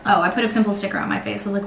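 usual speaking voice

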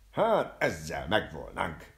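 exult, stretching the words; exultant. A narrator's reading. Barber delighted with his shot, loud exultation. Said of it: "újjongott a borbély".

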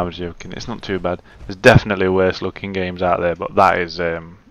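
ordinary talk, nothing marked

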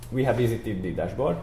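ordinary delivery

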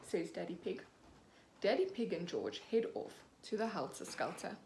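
ordinary delivery

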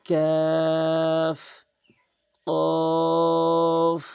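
কাফ and কফ held very long; slow Quranic recitation of letter names; long drawn-out letters. Shown as a demonstration. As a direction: chant each name slowly, stretched. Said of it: "তিন আলিফ টেনে বলবেন".

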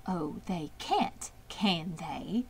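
soft, slow murmur to herself; musing quietly, half to herself; a hushed private murmur. A narrator's reading. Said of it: "murmured Charlotte to herself".

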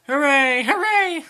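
very high, loud hooray; cheering. A narrator's reading. Engines cheer the trip to the city, excitedly. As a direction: whoop it up high.

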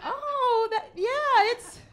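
high, singsong mommy voice; humoring. Retold or imitated. Mother responding to little son's proud announcement, humoring singsong. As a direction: coo it way up high, swooping down.

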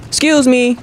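high-pitched sassy request; sassy excuse me. Pretend. Gamer to a character in his way, sassy. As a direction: say it high and pointed. First call to get past character.

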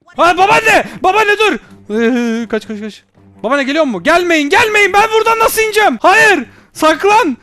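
high, loud frantic cries to keep her away; panicked yelling. Real, not acted. Player yelling at approaching grandma, panicked and loud. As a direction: shout in real panic, voice jumping up.

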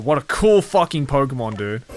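ordinary talk, nothing marked

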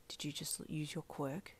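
low hushed whisper, anger held down; a dad barely containing his frustration; frustrated whisper. A narrator's reading. To his daughter about her quirk, a hushed frustrated whisper. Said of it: "with frustration boiling in his tone".

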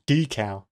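decal said DEE-cal, full second syllable; long-E decal. Shown as a demonstration. Streamer testing a word that sounds wrong, decal with long E. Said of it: "which sounds wrong".

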